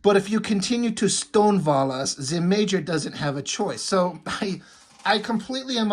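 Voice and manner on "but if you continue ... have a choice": hammed-up German-accented threat. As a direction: play it as a comic-book German. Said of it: "like a German accent".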